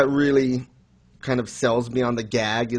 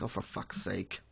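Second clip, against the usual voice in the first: much softer, fed-up under-breath curse; muttered exasperation; 4 semitones lower, 16 dB quieter than usual. Retold or imitated. Recalling his reaction to the chapter, softly fed up. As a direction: mutter it like you've given up. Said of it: "And I'm like".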